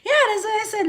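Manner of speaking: laughing, high. Real, not acted. Laughing at compliment.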